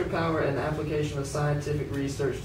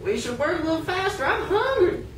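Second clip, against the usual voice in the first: put-on much higher child's voice; master's child whining about dinner, high and whiny; 12 semitones higher than usual. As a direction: whine like a hungry kid.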